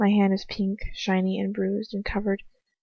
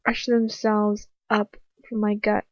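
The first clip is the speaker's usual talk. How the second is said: ordinary delivery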